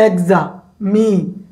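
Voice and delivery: "Examination" in four separate low chunks with gaps; split into syllables. Shown as a demonstration. Teacher showing how many parts there are, "examination" broken into four. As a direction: say "examination" as এক্সা, মি, নে, শন, pausing between each. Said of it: "তিনের অধিক কয়টা অংশ হইছে".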